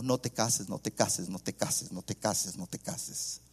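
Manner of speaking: low, hushed, fading repeated plea; mock tearful pleading. Mother begging son not to marry, mock tearful. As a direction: imitate a weeping mother, trailing off to almost nothing. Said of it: "media hora la mamá llorando".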